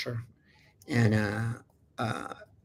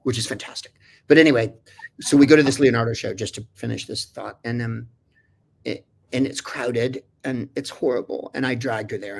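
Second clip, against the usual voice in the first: normal talking voice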